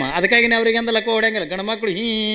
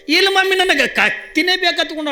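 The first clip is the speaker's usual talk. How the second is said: put-on high, wailing little boy refusing; stubborn crying child; 9 semitones higher than usual. A narrator's reading. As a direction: whine it high like a sobbing toddler. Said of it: "ಅಳ್ಳಕತ್ತು". (ಹಠ ಮಾಡಿ ಅಳುವ ಮಗುವಿನ ಎತ್ತರದ ದನಿ)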